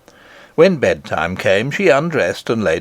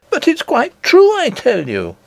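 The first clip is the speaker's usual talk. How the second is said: insisting princess; 13 semitones higher than usual. Acted.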